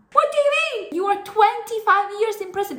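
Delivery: high, loud incredulous cry; loud shocked protest; outraged disbelief. Real, not acted. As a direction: shout at the absurdity.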